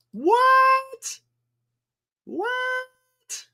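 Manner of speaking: squeaky high disbelieving what; a sudden high, incredulous cry; stunned disbelief. Real, not acted. Stunned at Dodgers standings.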